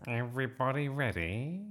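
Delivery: high, cheery vicar's question; beaming vicar. A narrator's reading. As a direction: call in to the room cheerfully.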